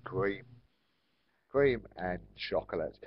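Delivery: ordinary talk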